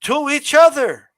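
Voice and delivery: slow, pausing, wooden line reading; mock the memorized promo delivery again, slowly; stiff scripted promo talk. Shown as a demonstration. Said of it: "like this".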